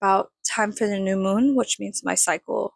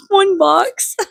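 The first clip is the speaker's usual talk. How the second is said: high, louder laugh that breaks into a cry; 12 semitones higher, 9 dB louder than usual. Real, not acted. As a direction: squeal it out laughing at yourself. Laughs at own messy habits.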